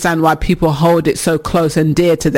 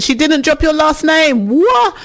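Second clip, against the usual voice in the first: can't believe what she reads; astonished; 11 semitones higher than usual. Real, not acted. Host reacting to a listener's ex keeping his name, astonished.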